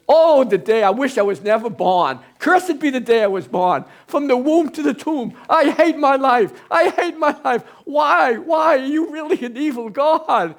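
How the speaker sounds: very high, loud despairing wail; Job cursing his birth. Acted. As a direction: cry out in full despair, near breaking. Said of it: "And he says".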